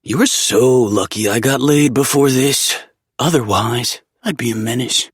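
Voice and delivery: playful friend voice. A narrator's reading. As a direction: tease him with a grin. Zev teasing.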